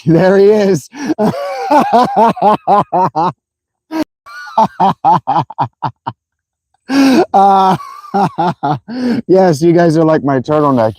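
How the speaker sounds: high, delighted burst of laughter; cracking up. Real, not acted. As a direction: announce it and dissolve into long laughter. Cracks up as Alec turns up.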